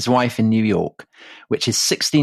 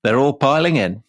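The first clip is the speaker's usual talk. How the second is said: put-on narrating in a light Yorkshire accent; a hint of Yorkshire storyteller; 3 semitones higher than usual. Yorkshire accent, telling the Leeds story to his co-host. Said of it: "in that accent".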